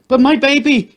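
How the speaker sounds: a mother's anguish; scream. A narrator's reading. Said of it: "she screamed again".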